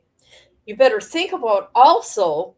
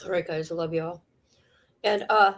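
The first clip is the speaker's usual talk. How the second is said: ordinary delivery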